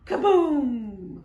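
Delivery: loud dramatic boom; booming kaboom. A narrator's reading. Reading to kids, thunder booms loudly. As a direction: let the thunder crash out. Thunder sound in read-aloud story.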